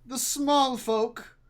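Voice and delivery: heightened husband's voice, easing off at the end; placating husband. Acted. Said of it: "he says".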